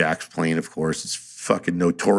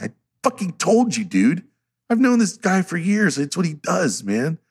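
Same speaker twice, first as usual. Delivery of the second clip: higher, exasperated told-you-so; incredulous told-you-so; 9 semitones higher than usual. Retold or imitated. To his exhausted buddy at four in the morning, exasperated. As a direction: rub it in, half laughing. Scolding friend after long wait.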